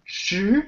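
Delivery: "Shi" rising as if asking a question. Shown as a demonstration. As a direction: lift the pitch through "Shi", second tone. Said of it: "So it's a rising tone".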